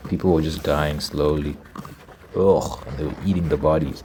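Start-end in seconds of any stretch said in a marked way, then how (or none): none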